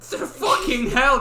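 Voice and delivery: high, loud, slow disbelief; mock outrage. Real, not acted. Friend reacting loudly to the story's greedy wife.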